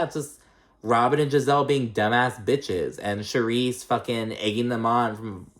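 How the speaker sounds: usual speaking voice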